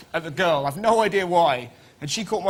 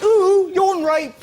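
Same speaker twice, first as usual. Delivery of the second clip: high drawn-out mock voice; 14 semitones higher, 7 dB louder, much slower than usual. Comic mimicry in a story.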